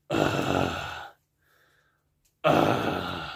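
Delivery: low held growl; quiet growl. Shown as a demonstration. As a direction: hold a soft rasping growl. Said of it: "try to hold that".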